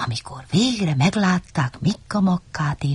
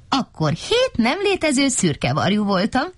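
high, tittering delivery; lightly acted giggly crow; 7 semitones higher than usual. A narrator's reading. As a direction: say it with a mock giggle, pitched up. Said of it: "vihogott Szeréna".

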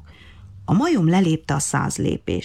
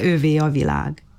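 plain reading voice

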